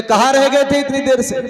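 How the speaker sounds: high-pitched mock scolding; scolding wife. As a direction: demand an answer like a cross wife. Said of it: "बड़ी जोर की डांट लगाई".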